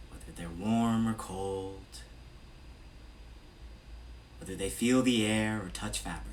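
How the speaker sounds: measured meditation-guide voice with long pauses between phrases; evenly projected, spacious meditation delivery; guided meditation. Real, not acted. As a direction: lead a body scan, unhurried and steady. Leads a body scan meditation.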